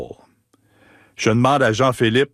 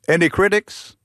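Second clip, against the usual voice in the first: higher, quizzical [name] voice with a British lilt; mock Paul; 8 semitones higher than usual. Imitating Paul asking the fan for critique, British accent. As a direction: lift it into a curious British question. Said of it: "Il me dit".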